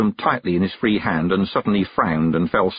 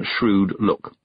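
usual speaking voice

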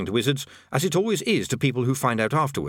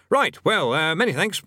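flustered; 7 semitones higher than usual. A narrator's reading. Bursar eager to end.

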